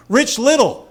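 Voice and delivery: a high, loud shout from the crowd; heckler's yell. Retold or imitated. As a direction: shout it from the back row. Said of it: "the guy behind me screams out".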